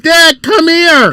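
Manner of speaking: put-on high, drawn-out child's call; imitating daughter calling. Father retells daughter at his side, imitated high child call. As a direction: stretch it like a kid summoning Dad. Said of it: "She said".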